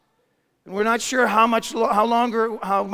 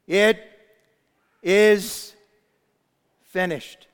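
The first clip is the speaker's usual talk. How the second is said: shouted; 4 dB louder, much slower than usual. Acted. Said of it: "and shouted".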